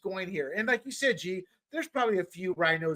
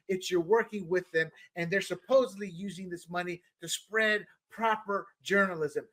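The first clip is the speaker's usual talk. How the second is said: normal talking voice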